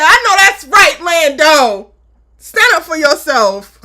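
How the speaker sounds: root him on at full volume; cheering shout. Retold or imitated. Host shouting support at Lando to stand firm. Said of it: "I said".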